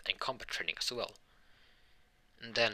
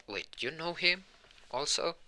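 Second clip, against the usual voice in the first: higher-pitched retold disbelief; surprised question; 9 semitones higher than usual. Deku finds out she knows him too, surprised. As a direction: lift the pitch in mock surprise.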